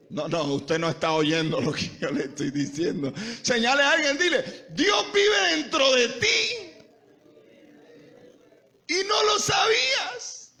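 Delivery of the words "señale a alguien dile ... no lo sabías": very high, loud, drawn-out cry; insistent preacher's shout. Acted. Preacher insisting the congregation tell their neighbour, very high insistent shout. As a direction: hammer it home at the top of the voice. Repeats command after crowd fails.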